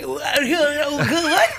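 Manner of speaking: high-pitched, squeaky mock shock; hammed-up starstruck squeal; stunned disbelief. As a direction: blurt it out high, half laughing. Reenacting starstruck shock at the call.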